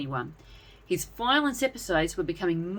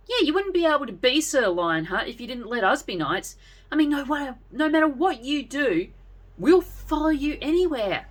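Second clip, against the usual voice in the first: put-on high, eager boy's voice; piping up; 8 semitones higher, 4 dB louder than usual. A narrator's reading. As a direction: chirp up with loyal enthusiasm. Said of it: "Sir Hyper piped up".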